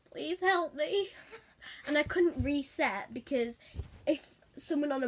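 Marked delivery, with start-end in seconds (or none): 0.1-1.1 mock plea. Pleading to watchers in game.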